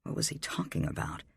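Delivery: plain reading voice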